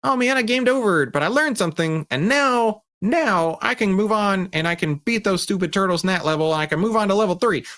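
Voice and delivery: put-on high, eager child voice; acting out a kid rallying after losing; determined little kid. Kid bouncing back from a game over, eager resolve. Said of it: "Or do you say".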